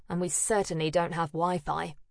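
ordinary delivery, nothing marked

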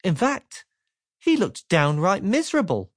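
ordinary delivery

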